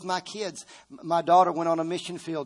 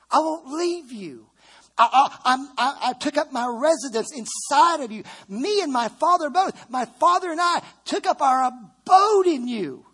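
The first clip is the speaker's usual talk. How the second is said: higher, louder preaching; full-force preaching; 10 semitones higher, 8 dB louder than usual. Acted. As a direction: preach it out with fire.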